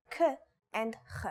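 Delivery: K, then Ch as a throaty rasped Hebrew sound; two letter sounds. Shown as a demonstration. Teacher showing learners both Chaf sounds, K then throaty Ch. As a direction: sound out K and Ch separately. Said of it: "Chaf is both".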